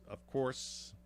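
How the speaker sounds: slipped into a New York accent. Real, not acted. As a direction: say it like a native New Yorker. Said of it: "my New York accent came out there".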